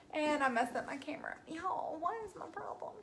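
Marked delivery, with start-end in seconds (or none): 1.5-2.9 high unvoiced whisper. Real, not acted. Painter fumbling the camera, sheepish whisper. Whispered self-scolding aside.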